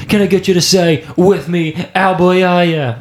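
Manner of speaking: put-on rally the crowd in an Aussie accent; Australian accent. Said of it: "all of a sudden he goes".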